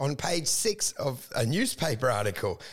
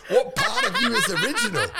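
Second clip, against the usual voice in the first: high, loud mocking yell; mock shout; 5 dB louder than usual. Yelling at the ex-wife's mother-in-law, mock shout.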